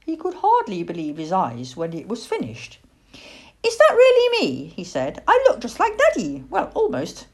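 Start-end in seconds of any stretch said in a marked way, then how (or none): 3.6-4.7 high, loud wonder; squeaky, astonished pleasure; thrilled owlet. A narrator's reading. Owlet thrilled by his portrait. 5.3-7.3 high, excited little voice; squeaky pleased owlet; proud owlet. A narrator's reading. Owlet thrilled by his portrait.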